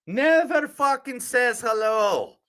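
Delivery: high, drawn-out Irish complaint; Irish accent. Acted. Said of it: "Thank you for the Irish accent".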